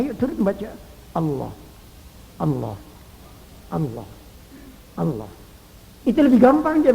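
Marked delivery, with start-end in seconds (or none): 1.1-5.3 lower, much softer, short repeated; soft whisper. Shown as a demonstration. Preacher whispering God's name to the dying. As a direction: murmur the name gently, over and over. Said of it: "Bisikkan ke telinganya".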